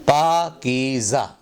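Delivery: पाकीज़ा slowly, long aa and ee, with z; correct pronunciation. Shown as a demonstration. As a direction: draw out each syllable of पाकीज़ा. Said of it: "लगा के पढ़ें".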